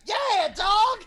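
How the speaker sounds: high shouted rat chorus; rats shouting. Acted. You hear in unison.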